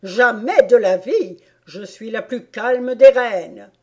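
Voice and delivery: loud, vehement royal denial; indignant queen. A narrator's reading. The queen leaping up at the king's question, indignant. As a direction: burst out loudly as an offended queen. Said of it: "répondit en bondissant".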